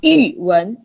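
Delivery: yuwen starting with a sharp fall; fourth tone. Shown as a demonstration. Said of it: "change it to the fourth tone".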